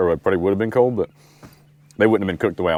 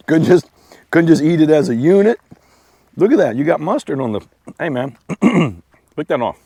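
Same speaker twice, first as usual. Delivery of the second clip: higher, louder playful scolding of a dog; teasing the dog; 7 semitones higher, 5 dB louder than usual. Real, not acted. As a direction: tease the dog in a high voice. Teasing his dog while it eats.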